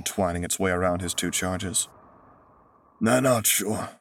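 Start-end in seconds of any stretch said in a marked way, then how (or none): 3.0-3.9 weak scraping rasp; broken, scraping hurt voice. A narrator's reading. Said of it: "a rasping voice replied".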